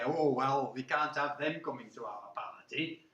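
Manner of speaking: low, soft, disdainful society voice; snobbish refusal. Acted. Society people exclude newcomers from the party, snobbishly. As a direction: turn them down with hushed snobbery.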